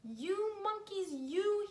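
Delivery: slow, high scolding of monkeys. A narrator's reading. Peddler scolds the cap-stealing monkeys, slow high scolding. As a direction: wag a finger and scold. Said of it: "shaking a finger at them".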